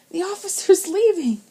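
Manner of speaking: high, loud squeaking cry, voice cracking; croaky squeak. A narrator's reading. Frightened boy squeaks as the officer drives off. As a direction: squeak it out in fear. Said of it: "between a croak and a squeak".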